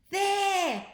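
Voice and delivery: high, loud pointing shout. A narrator's reading. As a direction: yell and point at the sky. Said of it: "shouted Ned".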